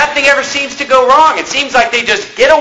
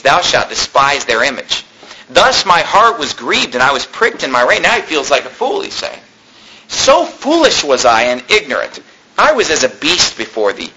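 measured King James reading, lower than his talk; scripture reading; 6 semitones lower than usual. Acted. Preacher reading the psalm to his congregation, solemn scripture reading. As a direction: read the psalm aloud to the congregation, unhurried.

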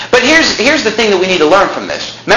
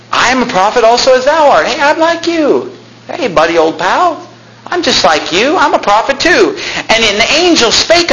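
put-on higher-pitched, over-friendly old prophet; mock chummy; 7 semitones higher than usual. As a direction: lay on the buddy act, pitch swinging up. Old prophet cozying up to younger one.

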